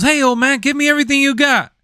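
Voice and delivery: high, fast squeaky thug voice; mock a goofy street robber, way up high; thief voice. Retelling meme, thief voice.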